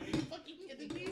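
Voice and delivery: incredulous aside. Acted. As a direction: blurt it to the other guards. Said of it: "I look at the other guards like".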